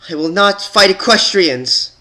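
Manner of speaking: high, loud angry cry; bare your teeth and shout the refusal. Acted. Twilight refusing to fight the rebels, angry shout. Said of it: "Twilight bared her teeth".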